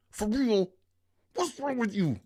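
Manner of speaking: very high, loud incredulous outburst; exasperated disbelief. Real, not acted. Streamer scolding the viewer who sent the clip, exasperated disbelief. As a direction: let the voice leap up in protest.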